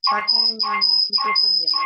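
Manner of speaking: echoing mic feedback. Real, not acted. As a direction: voice ringing back through the room. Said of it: "Tá ecoando aqui".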